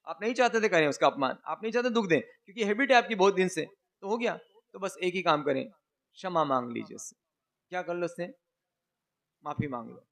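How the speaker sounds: usual speaking voice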